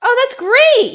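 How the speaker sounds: high, loud excited exclamation; loud, high thrilled cheer; delighted. Real, not acted. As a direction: burst out with delight. Excited at loot.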